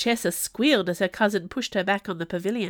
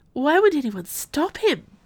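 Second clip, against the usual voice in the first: higher-pitched wondering thought; lightheaded; 7 semitones higher, 4 dB louder than usual. A narrator's reading. Velody wondering at the onlookers, lightheadedly. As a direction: lift the question, slightly giddy. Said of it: "Velody thought lightheadedly".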